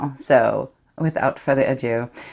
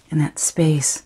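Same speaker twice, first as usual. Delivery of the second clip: whisper; 3 semitones higher, 4 dB louder, slower than usual. Acted. Secret thrill in monologue.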